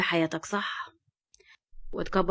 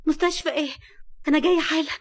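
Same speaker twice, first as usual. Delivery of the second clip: shocked alarm; 7 semitones higher than usual. Acted. Nour hears on the phone Fahd crashed, panicked. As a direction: react in sudden panic.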